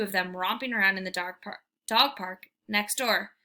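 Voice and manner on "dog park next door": ordinary delivery, nothing marked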